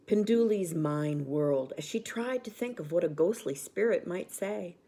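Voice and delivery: usual speaking voice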